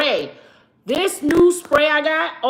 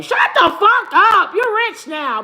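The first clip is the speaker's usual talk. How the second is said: mock yell; 6 semitones higher, 3 dB louder than usual. Yelling at the mopey man.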